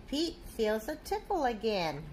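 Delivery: usual speaking voice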